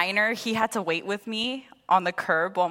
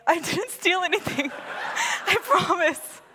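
laughing protest; 10 semitones higher than usual. Retold or imitated. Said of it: "I'm like".

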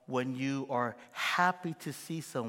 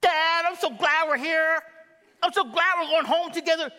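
put-on very high, loud child voice; happy little kid; 11 dB louder than usual. Twins thank their dad, in a very high happy child voice. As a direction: squeal it like a delighted child. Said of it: "You know what they say".

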